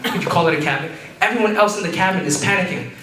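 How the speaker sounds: usual speaking voice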